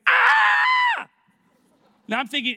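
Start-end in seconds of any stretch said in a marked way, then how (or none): 0.1-1.1 sudden high, loud scream; mimicked shriek at full volume; imitated scream. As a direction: let out a piercing "Ah!". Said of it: "Is that a good scream or a bad scream?".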